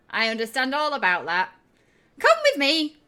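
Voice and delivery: high, loud character voice; brisk Shrilt. Acted. Shrilt takes charge of visitors.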